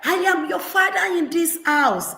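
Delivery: high, proclaiming declaration; pompous father. Retold or imitated. Father asserting authority over child, high and pompous. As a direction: puff up as the father of the house. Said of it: "it need to come from your mouth".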